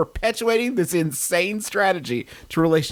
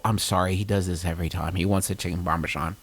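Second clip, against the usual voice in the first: embarrassed date; 8 semitones lower than usual. Acted. Acted date apologising to waiter.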